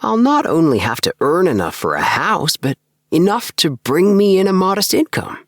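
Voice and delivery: put-on a bored young man venting his drudgery; lifted voice of a disillusioned clerk; Appleby's voice. Appleby explaining his plan.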